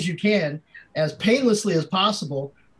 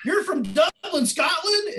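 much higher, amazed dude voice; impressed frat bro; 11 semitones higher than usual. Retold or imitated. Frat guy at the door, amazed dude voice. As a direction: echo it back like a stoked frat guy.

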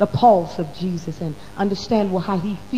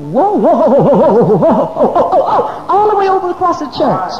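loud, high whooping with a laugh; laughing mock whoops across the church; mock shouting whoa; 9 semitones higher, 9 dB louder than usual. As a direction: whoop like the Spirit is sweeping the church. Acting out the Spirit's sweep.

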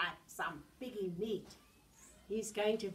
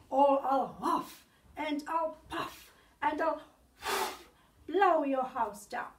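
loud, higher booming wolf call; 4 semitones higher, 8 dB louder than usual. Acted. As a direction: shout it up the door like the big bad wolf. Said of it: "said the wolf".

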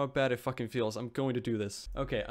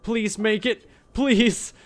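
urgent, loud pleading, pitched up; desperate plea; 6 semitones higher, 10 dB louder, much slower than usual. Real, not acted. Player begging to reach air before oxygen runs out, desperate plea. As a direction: beg loudly as the panic rises.

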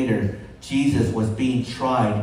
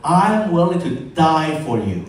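loud, raised-pitch pledge; bold vow; 4 semitones higher, 6 dB louder than usual. Acted. Peter vows loyalty in front of disciples, bold vow. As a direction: declare it boldly with force.

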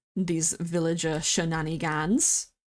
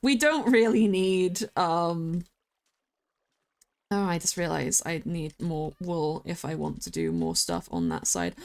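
ordinary talk, nothing marked

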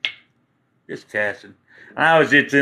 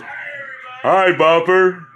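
echo voice; 3 semitones higher, much slower than usual. Retold or imitated. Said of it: "over there my voice goes".

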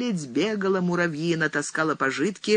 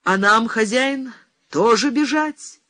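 higher, strong questioning voice; mighty voice; 7 semitones higher, 4 dB louder than usual. A narrator's reading. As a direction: ask it out powerfully, pitched up. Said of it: "спросила могучим голосом своим Павла".

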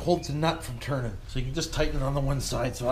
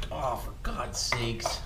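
ordinary talk

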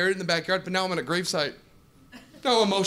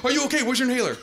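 worried owner; 6 semitones higher, much faster than usual. Retold or imitated.

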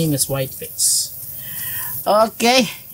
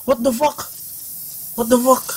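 startled; 9 semitones higher than usual. Real, not acted. Caught by the monster.

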